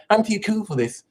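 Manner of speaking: mocking cool skeptic. Guru mocking skeptics who stayed away, mock sneer. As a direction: sneer it like a bored cynic. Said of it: "You know".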